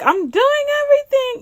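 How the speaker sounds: high, whiny mock cry; imagined panicking child. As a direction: imitate a child wailing in protest. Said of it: "He could have said, like".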